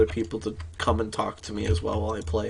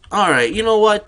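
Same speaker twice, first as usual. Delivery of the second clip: exasperated; 9 semitones higher, 8 dB louder than usual. Retold or imitated. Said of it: "And he's like".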